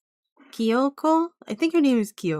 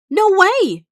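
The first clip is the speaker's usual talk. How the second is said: high, loud outburst; shocked disbelief; 10 semitones higher, 7 dB louder than usual. Acted. A listener stunned to hear Sato is immortal, shocked disbelief.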